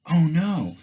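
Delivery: a high, loud jolt of alarm; sudden dread rising in the voice; oh no panic. Retold or imitated. Rider realizing he hit a dead end, alarmed cry.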